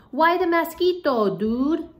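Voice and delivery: high squeaky small-dog voice; high voice of the smallest little dog. Acted. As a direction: pipe it up like the tiniest dog. Poquito Tito speaks.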